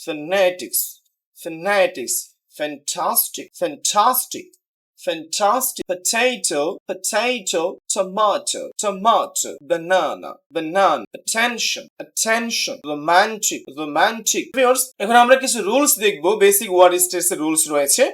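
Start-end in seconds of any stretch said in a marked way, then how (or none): 0.0-5.8 weight on NE of fanatics, TAS of fantastic; stress drill. Shown as a demonstration. Teacher drilling words for students to repeat, measured and emphatic. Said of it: "আমার সাথে practice করবেন". 5.9-14.4 strong, long middle in potato, tomato, banana; second syllable stressed in attention and romantic, then falling; stress drill. Shown as a demonstration. Teacher leading repeat-after-me practice, emphatic. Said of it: "আমার সাথে practice করবেন".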